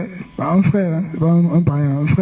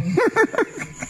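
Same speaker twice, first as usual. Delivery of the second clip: high laugh; 14 semitones higher, 6 dB quieter than usual. Real, not acted. Laughs at eye for eye.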